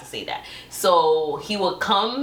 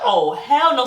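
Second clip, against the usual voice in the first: emphatic refusal; 5 semitones higher, 4 dB louder, slower than usual. Retold or imitated. Acted alarm at being photographed.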